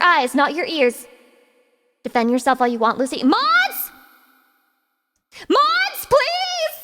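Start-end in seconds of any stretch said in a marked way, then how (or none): 3.2-6.8 calling the mods. Acted. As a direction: cry out for the mods, mock desperate.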